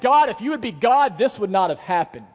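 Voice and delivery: loud, high-pitched protest; accusing God. Acted. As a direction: cry out to God in hurt. Acts a hurting believer accusing God.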